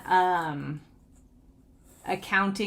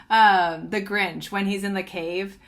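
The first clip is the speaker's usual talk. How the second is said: ordinary talk, nothing marked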